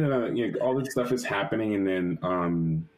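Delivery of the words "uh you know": ordinary talk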